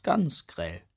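ordinary delivery

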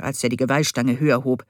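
ordinary delivery

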